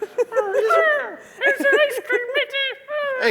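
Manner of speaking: very high, very slow squeaky voice; silly high voice. Shown as a demonstration. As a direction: stretch it out high and silly, not a pirate. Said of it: "Can you do it like".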